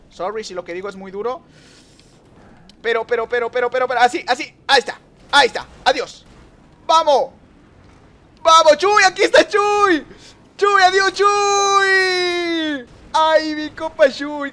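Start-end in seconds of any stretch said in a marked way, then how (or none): 2.8-6.3 loud, high rapid-fire repetition; urgent gamer commentary, raised voice; excited urgency. Real, not acted. Rapid reaction to race action. 6.9-14.5 high, full-voiced yell with long-held vowels; stretched, high celebratory scream; ecstatic shouting. Real, not acted. Celebrates catching up with Chuy.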